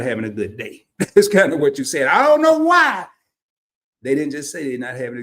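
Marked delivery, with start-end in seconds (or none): 2.1-3.1 exclaiming. Acted. Joking about the code words, high loud exclamation. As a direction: throw it out high and loud, stretching "why".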